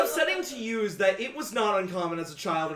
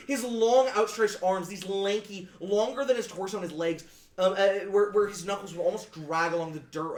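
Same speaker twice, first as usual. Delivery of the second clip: ordinary talk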